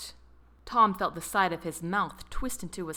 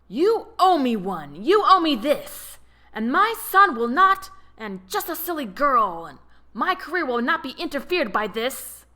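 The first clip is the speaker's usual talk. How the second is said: much louder furious shouting, higher; angry yelling; 6 semitones higher, 9 dB louder than usual. A narrator's reading. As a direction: rage at him, hard to understand. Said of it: "Robert was so angry".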